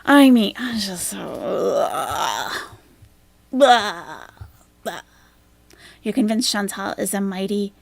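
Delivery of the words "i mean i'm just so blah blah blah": loud, slow fake-crying whine; mock whining. Streamer mocking Chantal's self-pity with loud fake crying. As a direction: ham up a sobbing complaint.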